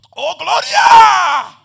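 long, drawn-out high shout; rapturous shout of glory; ecstatic cry. Retold or imitated. Cries out when pushed by Spirit.

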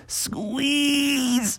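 high, soft, drawn-out strained squeak; squeezing in. Acted. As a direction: stretch it thin, as if wriggling through. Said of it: "started to squeeze his way in".

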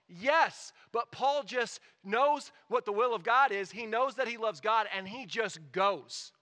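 loud, fired-up pulpit delivery; full-force preaching. Acted. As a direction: rouse the congregation to pray boldly.

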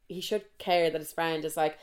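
ordinary talk, nothing marked

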